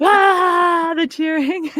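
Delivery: high, faked cheering crowd noise; mock crowd cheering noise. Shown as a demonstration. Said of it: "I'd be like".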